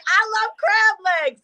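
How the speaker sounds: high, drawn-out mock squeal; excited mimicry. Mimicking the woman who wanted food, high excited squeal.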